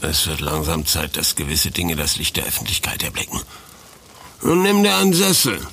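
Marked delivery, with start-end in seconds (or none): 4.4-5.6 much higher and louder, suddenly hearty; sudden cheerful call. Acted. Old lieutenant loudly invites the student to sit down. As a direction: boom it out with sudden warmth. Old man abruptly offers a seat.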